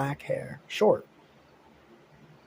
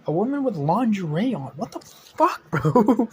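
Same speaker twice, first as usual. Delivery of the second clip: sudden jump way up and louder, stunned; shocked outburst; 7 semitones higher, 7 dB louder than usual. Acted. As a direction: blurt it out in total disbelief. Shocked reaction to lingerie woman.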